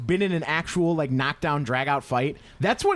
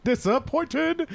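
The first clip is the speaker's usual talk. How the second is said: high, drawn-out mock outburst; imitación de Sorbo; 11 semitones higher, much slower than usual. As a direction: stretch the word into a hammed-up cry. Mimics Sorbo's famous outburst.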